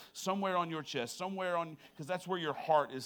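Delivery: ordinary talk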